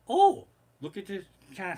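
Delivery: a loud little "Oh" as an imitated wife; wife cooing. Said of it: "my wife is there".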